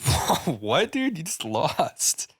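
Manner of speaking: acted incredulity, stretching the last words; imitating stunned onlookers; mock disbelief. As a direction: play the baffled crowd, drawn-out ending. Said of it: "everyone was like".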